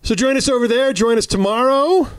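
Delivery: high, bright sign-off plug; upbeat plug. Real, not acted. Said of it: "repeated plug, raised".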